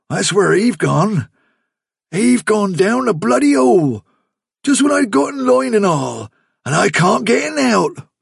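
the man complaining to Fiver, rising exasperation; exasperated rustic man. Acted. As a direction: grumble in rising frustration.

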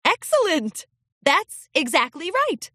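high, pleased exclamation, hint of laughter; delighted praise. A narrator's reading. Princess teacher delighted by a filly's answer, pleased. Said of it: "Celestia laughed".

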